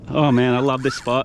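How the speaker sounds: ordinary talk, nothing marked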